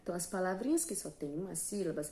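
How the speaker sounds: usual speaking voice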